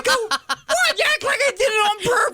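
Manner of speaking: very high, laughing outraged protest; host mocking his own outraged reply; indignant squeal. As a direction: play offended innocence. Retort to "killer" accusation.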